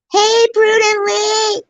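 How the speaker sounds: high, slow shrieked greeting; shrill calling out. Retold or imitated. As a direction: shriek the name across the store. Mimicking woman calling out.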